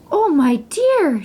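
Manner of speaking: lightly voiced motherly queen; queen's voice. A narrator's reading. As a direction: greet her like a fussing queen. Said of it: "said the queen".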